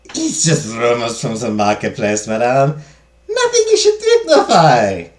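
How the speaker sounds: put-on slow maid voice in a German-sounding accent; accidental German accent. Maid dismisses market rumors to madame, German-sounding accent. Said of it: "I see the German. I hear the German now".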